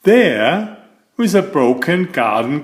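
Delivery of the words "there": usual speaking voice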